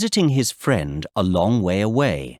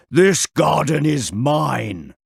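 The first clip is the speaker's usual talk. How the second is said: slow, gruff giant; 3 semitones higher, slower than usual. Acted. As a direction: growl it like a giant. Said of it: "he asked in a gruff voice".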